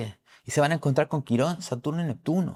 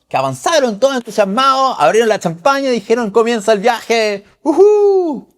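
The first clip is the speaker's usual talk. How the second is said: high, loud celebrating crew; excited cheer; 9 semitones higher, 10 dB louder than usual. Retold or imitated. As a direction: toast the launch, whooping. Astronautas celebrando el inicio. (astronautas celebrando con champaña, eufóricos)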